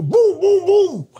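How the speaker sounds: loud, high gun booms; imitated gunshots. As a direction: fire off explosive mouth-made shots. Imitating his own gunshots.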